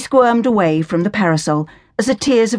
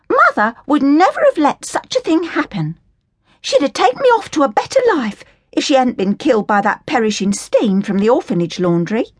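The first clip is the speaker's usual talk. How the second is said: put-on higher, scornful working-class girl voice; indignant Cockney orphan girl; 7 semitones higher than usual. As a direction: sneer at the Sally Army in a higher, cheeky voice. Hilda defends her mother.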